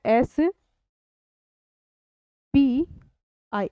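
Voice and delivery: एस, बी, आई spelled as separate letters with long pauses. Shown as a demonstration. Teacher spelling the acronym for the class, letter by letter. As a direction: spell out एस बी आई one letter at a time. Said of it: "अब एक एक करके बोल रहे हैं".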